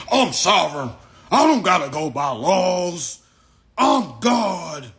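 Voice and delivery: loud, high mock proclamation; hammed-up courtroom declaration; mock defiant shout. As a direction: bellow it like a deluded defendant. Mimics blustering defendants in court.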